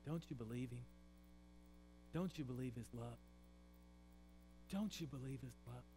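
preacher softly asking the congregation about God's love; hushed pleading question. Acted. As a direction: ask it quietly, like a heartfelt plea. God asks you in silence.

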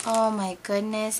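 usual speaking voice

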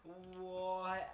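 slow, loud, drawn-out "what do you mean"; retelling his baffled reply, slow and loud; baffled retold reply. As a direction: stretch it out in disbelief. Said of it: "I was like".

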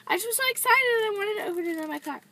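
fast giddy apology; excited rush. Real, not acted. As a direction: blurt it out in excitement.

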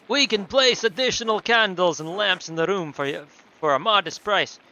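put-on loud accented innkeeper; Nico from GTA IV. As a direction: pitch the offer in a put-on foreign accent. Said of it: "do Nico from G T A four".

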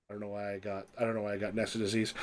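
muttered quickly under the breath; quiet aside. Real, not acted. GM muttering aside about himself.

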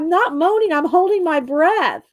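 high, swooping protest, slowing at the end; mock indignant retort. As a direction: playfully act out defending yourself to him. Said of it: "I'm going".

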